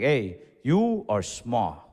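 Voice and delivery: low, slow, deliberate verdict; imitating a matter-of-fact elder; blunt grandparent. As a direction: break the news slowly in a low voice.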